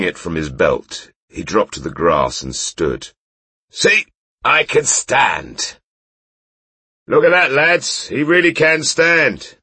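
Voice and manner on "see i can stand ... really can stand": high, loud tipsy boast; proud drunk showing off; drunk bragging. Acted. Drunk knight shows off.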